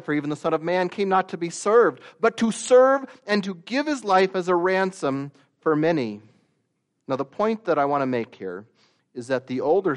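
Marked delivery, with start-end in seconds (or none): 0.0-6.2 raised, fast reading of the verse; scripture reading. Acted. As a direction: proclaim the verse up high and quick. Reads scripture aloud.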